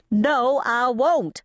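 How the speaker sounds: high, upset protest; distressed refusal. A narrator's reading. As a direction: refuse to be comforted. Upset character refuses comfort.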